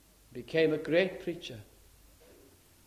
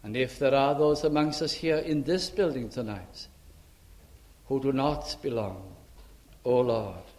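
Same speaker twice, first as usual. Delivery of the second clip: prayer; 2 semitones lower than usual. Acted. Leads a prayer.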